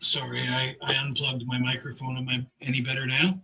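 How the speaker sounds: quiet and distant, off-mic; far from mic. Real, not acted. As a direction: sound like you're in another room. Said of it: "You still sound like you're in another room".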